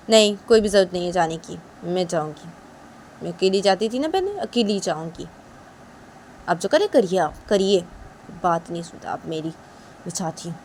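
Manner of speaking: daughter-in-law sulking at mother-in-law; sulky Uma. Acted. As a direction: refuse coldly and go.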